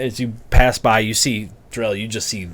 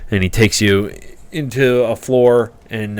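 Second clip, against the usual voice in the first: ordinary delivery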